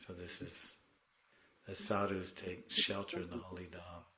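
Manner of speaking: ordinary delivery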